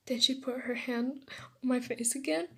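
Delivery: ordinary delivery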